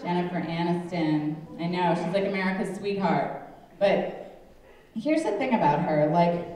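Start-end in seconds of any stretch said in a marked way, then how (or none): none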